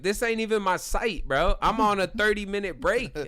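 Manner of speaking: acted exasperated defence; retold indignation. Retelling his indignant defence to stranger.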